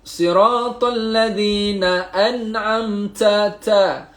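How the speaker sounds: an'amta with a breathy ta, air escaping; ta with a puff of air; aspirated ta. Shown as a demonstration. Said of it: "Nak sebut huruf T ataupun ta".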